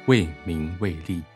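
ordinary delivery

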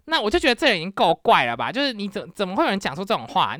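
usual speaking voice

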